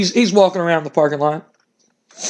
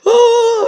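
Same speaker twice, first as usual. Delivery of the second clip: high, louder Scooby-Doo style scream; cartoon scream; 5 dB louder than usual. Trapped wrestlers screaming in the flipped car, cartoon shriek. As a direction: shriek like a cartoon seeing a ghost. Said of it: "they're screaming like".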